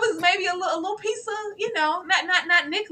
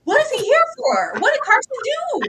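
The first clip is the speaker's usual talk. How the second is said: panicked excited; 2 semitones higher, 4 dB louder than usual. Retold or imitated. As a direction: spill the panic out fast. I was like.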